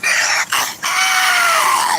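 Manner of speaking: very high shriek of laughter. Real, not acted. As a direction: let out a screaming laugh. Big laugh.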